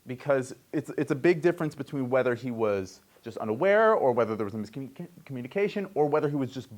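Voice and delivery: ordinary talk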